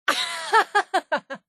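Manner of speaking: bursts out in a high, helpless laugh; high burst of laughter at a crude joke; big laugh. Acted. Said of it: "Sorry, but that was funny".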